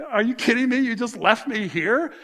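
incredulous at being left behind; retold exasperation; disbelief. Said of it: "I'm like".

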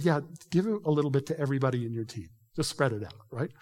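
usual speaking voice

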